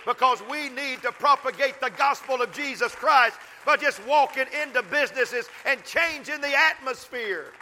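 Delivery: high, loud preaching climb; fiery preaching. Acted. Pastor urging the congregation about the workplace, fiery preaching.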